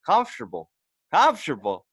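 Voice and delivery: high, incredulous echo; retold incredulity. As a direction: repeat it back in mock disbelief.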